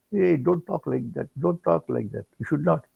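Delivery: calm him down quietly; gentle rebuke. Retold or imitated. Swami hushing his upset devotee, soft and firm.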